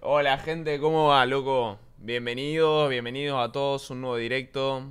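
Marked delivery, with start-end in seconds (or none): none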